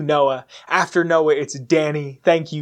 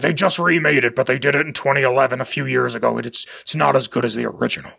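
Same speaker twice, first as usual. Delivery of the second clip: low-pitched impression of a history teacher; deep-voiced teacher; 3 semitones lower than usual. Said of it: "he had this very deep voice".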